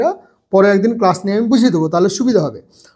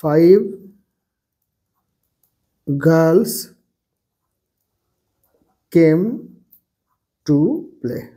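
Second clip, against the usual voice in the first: put-on Five girls came to play, each word apart and lower; slow dictation; 5 semitones lower, much slower than usual. Shown as a demonstration. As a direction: dictate it slowly, pausing after every word. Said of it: "girls হবে". (ধীরে ধীরে থেমে থেমে বলা)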